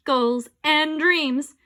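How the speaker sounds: slow, loud, drawn out; pompous drawl. Acted. Mayor droning through wedding speech, slow. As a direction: stretch each word grandly.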